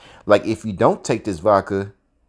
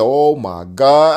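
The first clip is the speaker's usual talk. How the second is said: slow exasperated; 3 semitones higher, 8 dB louder, much slower than usual. Retold or imitated. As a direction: stretch it out in disbelief. Exasperated at Fred.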